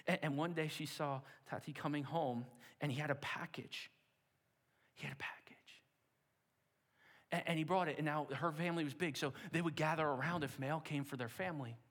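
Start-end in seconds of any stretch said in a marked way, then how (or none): 5.0-5.8 breathy hushed repeat, much softer; hushed storyteller secret; suspenseful whisper. Acted. Dramatic build in the story.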